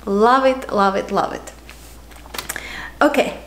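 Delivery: normal talking voice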